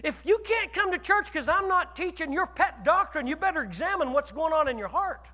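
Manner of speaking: preacher meddling with the flock, heated; fervent preaching. Acted. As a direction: press the point on the congregation. Preaching aside to congregation.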